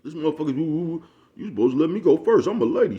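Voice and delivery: mock her squealing she's owed first place; mocking high-pitched woman. Mimicking the daughter demanding to go first, high and loud. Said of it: "this bitch had the nerve to get back in the car talking about".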